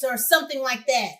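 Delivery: normal talking voice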